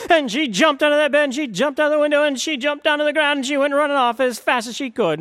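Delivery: storyteller caught up in the chase; excited telling. A narrator's reading. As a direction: race through the escape. Goldilocks flees, climax.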